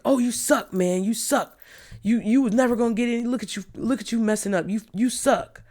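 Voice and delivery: mock someone standing over their shoulder; belittling taunt. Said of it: "belittling his brother".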